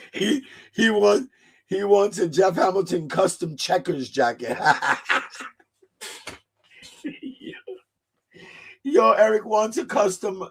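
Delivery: talking through laughter; laughing at a friend's request. Real, not acted. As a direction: laugh while you tell it.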